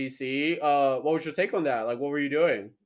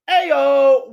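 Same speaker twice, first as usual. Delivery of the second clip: loud high call to open the show; energetic show opener; hype greeting; 8 semitones higher, 9 dB louder than usual. Real, not acted. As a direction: shout it like a host opening. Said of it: "show intro hype".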